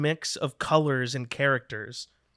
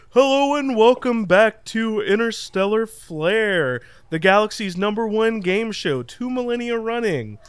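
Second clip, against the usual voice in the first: higher, cheesy TV host voice; game show host; 5 semitones higher than usual. Acted. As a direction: greet the crowd like a slick host. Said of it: "He steps out and he says".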